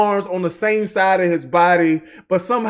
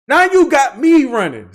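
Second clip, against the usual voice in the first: louder with stress on you; louder, punched; 5 semitones higher, 5 dB louder than usual. Real, not acted. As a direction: hit you hard, louder.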